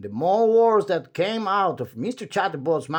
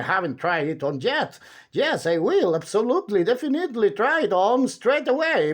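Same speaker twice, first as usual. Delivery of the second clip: endless chatterer; 3 semitones higher, 4 dB louder than usual. Acted. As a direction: ramble on brightly without pausing for breath. Chattering character voice.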